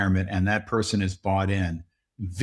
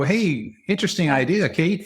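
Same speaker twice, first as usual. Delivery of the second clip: polite feigned interest; 7 semitones higher than usual. Acted. Team politely humouring boss's idea.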